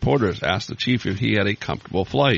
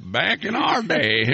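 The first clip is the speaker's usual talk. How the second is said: put-on old-timer voice; 7 semitones higher, slower than usual.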